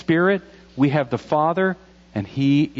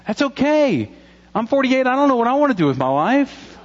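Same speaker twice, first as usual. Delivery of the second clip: high, loud comic self-mockery, much higher than his usual voice; mock panic; 12 semitones higher, 5 dB louder than usual. Pastor joking to the congregation about being forty-eight, high mock panic. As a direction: play up a fake midlife crisis for laughs.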